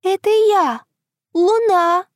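high thin voice from above; tiny high voice answering from the sky; thin little voice. Acted. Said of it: "тоненький голосок отвечает сверху".

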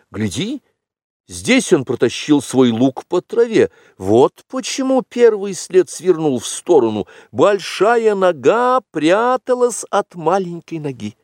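put-on high, excited boy tracker; eager young Mowgli. Mowgli showing Bagheera the tracks, high and excited. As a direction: explain the clues eagerly, pitched high.

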